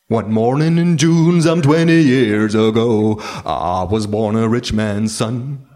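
slow English song lyric, fading down; drunken recital. Acted. Drunk kangaroo reciting the lyric at the jukebox.

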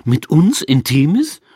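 slow, loud, rising disbelief; drawn-out, high incredulous question; incredulous echo. Acted. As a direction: throw the words back in disbelief. Incredulous echo in acted dialogue.

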